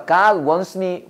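very slow, word by word; slow it down so they can copy; slow model phrase. Shown as a demonstration. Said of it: "해봐".

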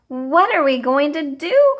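loud, high, desperate cry; a mother at her wits' end. A narrator's reading. Missus Cream cries out over her changing daughter, desperate and loud. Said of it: "cried Missus Cream".

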